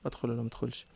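almost gone by the last ask; fading hesitant whisper. Acted. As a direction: lose the nerve mid-question.

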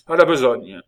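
loud urgent call; rally your partner to work; cry out. A narrator's reading. André urges his fellow prisoner to start, loud call. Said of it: "s'écria-t-il".

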